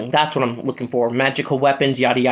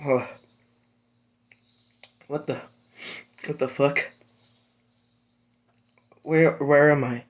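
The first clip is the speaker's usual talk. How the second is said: softer, dazed mumble; groggy waking; 5 dB quieter than usual. Acted. Deku waking in a strange bed, dazed and hushed. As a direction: come to, confused and hushed. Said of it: "wakes up in this bed and goes".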